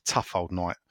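ordinary talk

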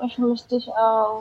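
softly repeated, barely voiced; soft echo. Real, not acted. Repeated, much softer echo.

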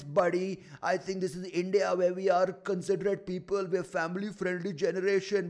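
high, loud put-on voice of a prudish critic; offended audience member. Offended gig-goer lecturing the comic at the bar, high mock scolding. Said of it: "come to the bar after and say".

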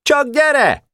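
very high-pitched challenge; defiant shout. A narrator's reading. Barabás challenging the climbing Pásztor, defiant shout. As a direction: dare him in a high cry. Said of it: "kiáltott Barabás".

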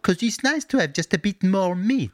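put-on hammy vague continental European accent; generic Euro accent. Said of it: "That is my generic offensive Euro voice".

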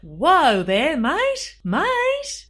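hammed-up Australian, high rising "mate"; playful mock-Australian accent. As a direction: put on a broad Australian accent, swooping upward. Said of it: "but in an Australian accent".

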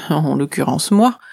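plain reading voice

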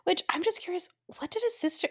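hushed, breathy aside; whispering. Real, not acted. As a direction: drop to a secret whisper. Curious aside to co-host.

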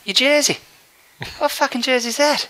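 hammed-up Irish demand, voice pitched well up; Irish accent. Stranger demanding about the jersey, hammed-up Irish accent. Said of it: "the accent was Irish".

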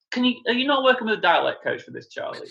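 fast, high, laughing exasperation; mock exasperated aside to an actor; exasperated plea. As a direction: plead with him, half laughing. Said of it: "it's always like".

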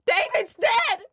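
high, loud TV wail; dramatic wail. Retold or imitated. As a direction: wail it like the TV moment. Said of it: "on Big Brother".

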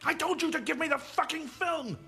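high, loud, enraged demand; full-throated angry yell; furious scream. Acted. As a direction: scream it at the man defying you. Gunman screams his demand again.

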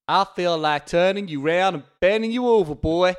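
loud, put-on bail jumper; hammed-up threatening outlaw voice; fugitive's voice. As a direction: play the menacing man on the run, loudly. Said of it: "Do the voice".

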